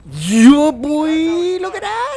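loud high victory yell; loud high crowing after a kill; triumphant shout. Real, not acted. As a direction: whoop it up. Celebrating a kill.

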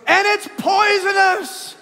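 put-on high, slow shouted child warning; small girl adding the scary detail; alarmed little girl. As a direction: shout it like a frightened kid. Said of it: "And Paisley goes".

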